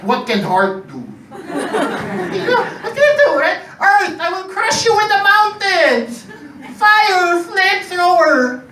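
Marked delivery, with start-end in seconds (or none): 1.3-3.6 high, slow, drawn-out mockery; mocking high voice. Mocking heart element. 3.8-8.6 barbarian. Acted. Acting the element attacks.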